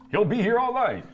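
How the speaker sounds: high, loud showbiz patter; mock emcee. Co-host ribbing the joker, mock emcee call. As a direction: call it out like a club announcer.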